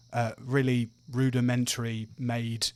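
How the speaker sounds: usual speaking voice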